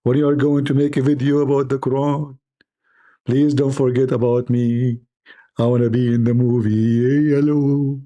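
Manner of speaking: hammed-up cat calling out. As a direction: wheedle like a meowing cat. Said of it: "Didn't she call you again and again".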